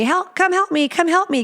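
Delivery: a mock needy plea; anxious partner pleading. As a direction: beg them to come back, voice up high.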